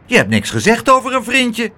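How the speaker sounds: high-pitched little doorman objecting; accusing, in a small high voice; suspicious protest. A narrator's reading. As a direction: protest it with wary indignation. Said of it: "riep het portiertje argwanend".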